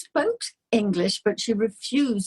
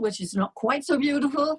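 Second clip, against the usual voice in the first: ordinary delivery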